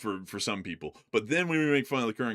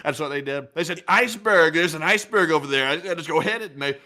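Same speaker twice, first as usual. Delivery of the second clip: higher, louder bragging put-on voice; boastful politician impression; 6 semitones higher, 5 dB louder than usual. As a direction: brag like a pompous politician, quoting fawning aides. Held bragging impression with quotes.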